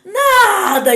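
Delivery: put-on boy denies everything, wailing; crying child's yell. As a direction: shout it like a kid bursting back into tears. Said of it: "E começou a chorar de novo".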